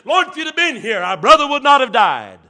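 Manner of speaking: high, loud plea to the Lord; anguished quoted plea; loud pleading cry. Acted. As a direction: cry it out like a grieving sister.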